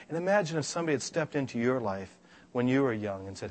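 usual speaking voice

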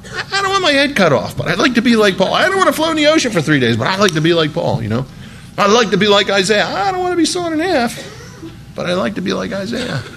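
half-hearted mock of a churchgoer wanting glory without pain; a hint of a whiny believer; mock wishful believer. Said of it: "we say to ourselves".

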